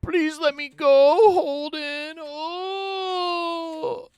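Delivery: very high, slow, whiny begging voice; mock pleading Magnus. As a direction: beg for mercy like a sobbing captive, squeaky and high. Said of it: "until he cries and cries".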